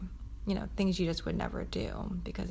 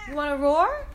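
playful coaxing; 7 semitones higher, 5 dB louder than usual. Real, not acted. Coaxing child to roar.

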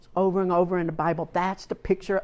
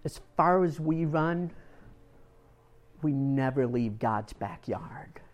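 hushed; 2 semitones lower, 6 dB quieter than usual. Real, not acted. Preacher closing his point to the congregation, whispered.

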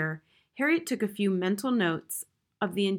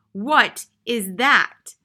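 loud, slow, outraged shriek; shrieked, drawn-out demand; 3 semitones higher, 9 dB louder, much slower than usual. A narrator's reading. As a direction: stretch each word in a loud cry. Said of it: "Missus Plummer shrieked".

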